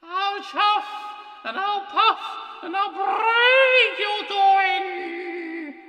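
very high, loud ghostly wail; spooky threat. Acted. Percy's ghost threatens Toby, spooky wailing. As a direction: howl it out, stretching the last words.

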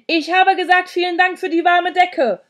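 loud, high shout; bellowing. A narrator's reading. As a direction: yell thanks to someone hard of hearing. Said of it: "brüllte Sandro".

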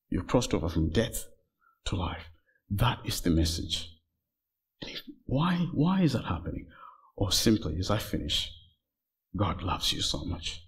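very soft, low closing appeal; hushed solemn preaching. Real, not acted. Preacher tells listeners God loves them as he finishes, hushed. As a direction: bring it down low and quiet, with weighty pauses.

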